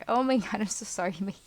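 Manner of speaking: usual speaking voice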